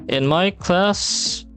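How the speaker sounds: ordinary talk, nothing marked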